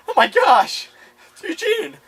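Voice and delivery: very high, loud shocked cry. Retold or imitated. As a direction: cry out in alarm. Lizzie recognising the body.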